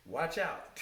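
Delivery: laughed-out punchline; laughing warning. Real, not acted. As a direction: joke a warning through a laugh. Jokes about being seen running.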